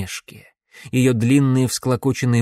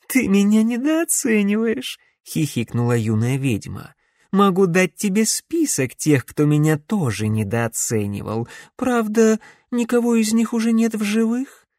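femme fatale; 8 semitones higher than usual. A narrator's reading. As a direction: lure him in, mock-hurt. Voices the witch.

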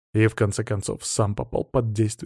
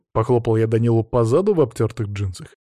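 plain reading voice

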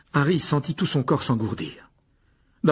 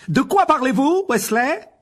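much higher and louder, slow snapping teacher; barked; 14 semitones higher, 8 dB louder, slower than usual. A narrator's reading. The professor barks at Ron, angry. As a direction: bark the question at him.

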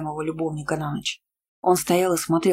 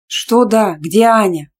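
loud, raised-pitch questioning; forceful, alarmed questions; loud demand; 3 semitones higher, 7 dB louder, slower than usual. A narrator's reading. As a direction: demand an answer, loudly. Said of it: "громко спросила я".

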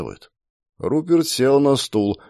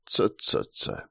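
tutting; 13 semitones lower, 10 dB quieter, slower than usual. Acted. Markus tuts to silence Rupert.

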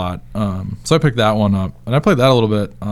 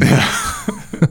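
laugh; 5 semitones higher than usual. Real, not acted. Laughing at the Australian joke.

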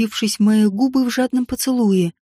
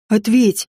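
demanding; 2 semitones lower than usual. A narrator's reading. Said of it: "потребовал Максиан".

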